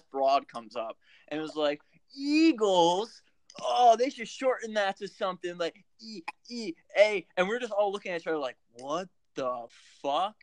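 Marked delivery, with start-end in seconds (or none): 2.1-7.3 higher, louder mock drunk woman; higher, louder drunk heckler imitation. Drunk woman quoted in story. 8.7-10.4 disbelief. Retold or imitated. Group's stunned reaction retold.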